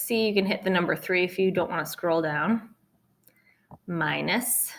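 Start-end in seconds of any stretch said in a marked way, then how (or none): none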